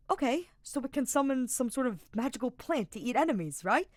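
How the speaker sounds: high, loud, hopeful pitch; eager guessing. A narrator's reading. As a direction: rattle off wild guesses. Sylvain keeps guessing eagerly.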